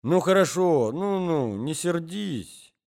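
slow singsong coaxing; old husband soothing his cross wife, slow singsong. A narrator's reading. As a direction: drawl it soothingly, high and slow. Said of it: "возразил старичок нараспев".

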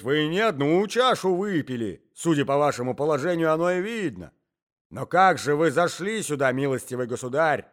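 put-on loud, exasperated old man; agitated shouting. A narrator's reading. Old husband questioning the intruder, agitated shouting. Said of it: "весь дрожа от волнения".

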